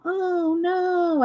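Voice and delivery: high nasal whiny nerd voice; cousin Gerard. Retold or imitated. Host shows her own Gerard moment, nasal whine. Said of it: "I think of cousin Gerard".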